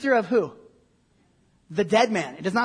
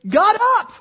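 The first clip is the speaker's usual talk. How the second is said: high, loud burst; amazed exclamation; 10 semitones higher, 8 dB louder than usual. Real, not acted. Preacher picturing Lazarus rising from the dead, loud amazement. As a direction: punch it out high, as a climax.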